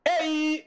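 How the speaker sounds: "Ei" held high and level; open diphthong mistaken. Shown as a demonstration. Teacher showing a diphthong to reject, "Ei" held high. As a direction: hold "Ei" long on a high note. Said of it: "ei não".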